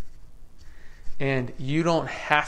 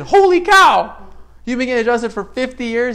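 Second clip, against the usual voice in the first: astonished shout; 9 semitones higher, 12 dB louder than usual. Retold or imitated. Shocked by the painter's fifty years of adjustments, astonished shout. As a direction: blurt it out in sheer astonishment. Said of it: "I said".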